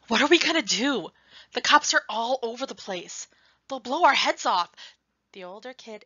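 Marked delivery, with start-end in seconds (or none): 0.1-4.7 higher, louder "What are we gonna do?" in panic; rising panic, nearly shouting; panicked. Acted. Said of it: "then turns back to the older kid and says".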